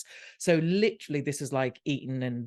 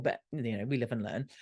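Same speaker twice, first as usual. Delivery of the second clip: usual speaking voice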